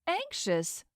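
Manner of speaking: high, mocking sneer. A narrator's reading. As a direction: sneer the word out. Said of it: "Madeline sneered".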